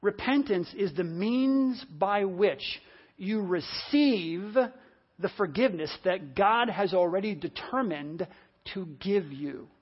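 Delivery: very slow, deliberate repeat for note-takers; slow dictation. Real, not acted. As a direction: spell the sentence out phrase by phrase, unhurried. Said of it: "I'll try to say it slowly".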